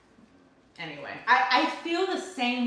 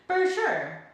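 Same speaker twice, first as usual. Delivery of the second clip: drawn-out Californian "For sure", higher and bright on "For"; Californian accent; 5 semitones higher, 3 dB louder than usual. Shown as a demonstration. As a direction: say "For sure" like a laid-back California local. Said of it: "my accent".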